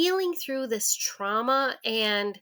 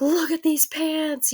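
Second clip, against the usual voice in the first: put-on higher, slower, thrilled little girl showing off; higher, excited kid voice; excited child; 7 semitones higher, 4 dB louder, slower than usual. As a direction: show off the new pants with delight. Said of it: "all excited".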